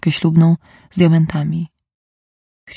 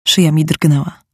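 ordinary delivery, nothing marked

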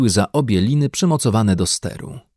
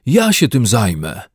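mildly raised, eager call; volunteer out loud, self-important; lightly acted keen bear; 4 dB louder than usual. A narrator's reading. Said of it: "zawołał Paddington".

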